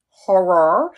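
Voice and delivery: "horror" drawn out as "hor-ror"; own pronunciation of horror. Shown as a demonstration. Teacher repeating her own way, slow "HOR-ror" pronunciation. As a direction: stretch "horror" with two rounded "or" sounds. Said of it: "I say horror".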